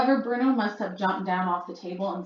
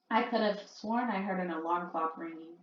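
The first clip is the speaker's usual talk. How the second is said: ordinary delivery, nothing marked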